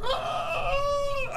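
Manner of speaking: high squeal as she tumbles; a tiny high scream of falling. Acted. Granny falling from chair, high squeal.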